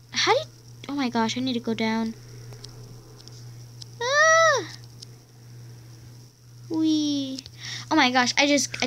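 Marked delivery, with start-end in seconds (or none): 4.0-4.7 sharp high yelp as she drops; startled yelp. Real, not acted. Kid falling in game, yelping in surprise.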